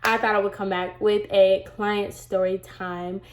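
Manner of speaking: usual speaking voice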